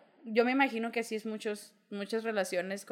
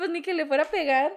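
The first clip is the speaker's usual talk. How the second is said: high, louder teasing protest to mom; mock whine; 7 semitones higher, 5 dB louder than usual. Daughter protesting to her mother, high mock whine. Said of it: "le dije".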